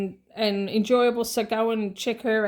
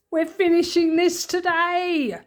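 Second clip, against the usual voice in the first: excited; 7 semitones higher, 4 dB louder than usual. Real, not acted. Announcing the final budget session.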